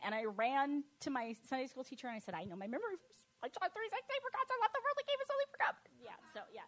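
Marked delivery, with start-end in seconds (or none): 3.4-4.2 put-on much higher, eager child recital voice; little girl reciting. Child reciting memory verse. 4.2-5.9 put-on much higher, rushed childish recitation; girl rushing the verse for a prize, childish and high; eager child recital.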